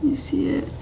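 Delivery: soft breathy whisper. Real, not acted. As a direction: whisper it close to the mic. Whispering to camera.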